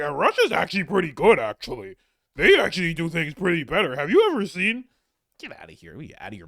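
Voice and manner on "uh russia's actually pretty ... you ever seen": high, loud nasal-free mock of a basement dweller; basement guy impersonation. Mocking a basement guy who praises Russia, high and loud. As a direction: whine it up high, smug and loud. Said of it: "that was a real impersonation I just did there".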